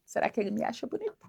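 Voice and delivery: soft, hushed musing to herself; dreamy inner thought. Retold or imitated. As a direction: wonder about him quietly, almost breathless. Rachel pensando sobre o segurança. (Rachel se pergunta baixinho se ele a acha bonita)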